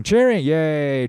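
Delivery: drawn-out sneering cheer; mocking yay. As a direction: lefties cheering for Trump, a slow mock yay.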